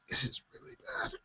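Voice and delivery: breathless exhausted whisper. Real, not acted. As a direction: barely get the words out, drained. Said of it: "I'm trying to keep myself together here".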